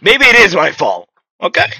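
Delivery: heated rant at the game character; indignant rant. Real, not acted. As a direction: scold the dead character, fired up.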